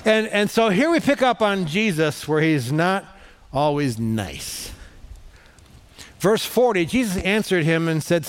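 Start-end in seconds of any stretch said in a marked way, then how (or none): none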